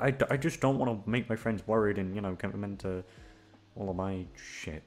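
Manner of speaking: ordinary talk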